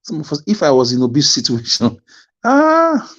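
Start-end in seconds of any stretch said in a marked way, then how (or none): 2.4-3.1 long, high-pitched "aah" with a laugh; exclaimed "ah". Acted. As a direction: cry out a loud, drawn-out "ah". Imagines himself in Obi's place.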